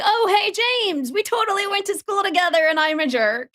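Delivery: high, over-bright imagined greeting; hammed-up high-pitched hello; mock recognition. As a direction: greet an old schoolmate way up high. Imagined awkward recognition of old schoolmate.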